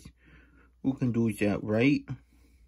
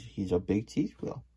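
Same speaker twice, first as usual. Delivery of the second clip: ordinary delivery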